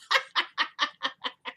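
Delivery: high, helpless burst of laughter; real cackling laugh. Real, not acted. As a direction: crack up in a long high laugh. Cracks up at the line.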